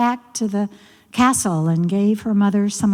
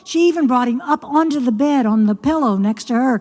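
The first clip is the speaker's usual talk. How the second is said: ordinary delivery, nothing marked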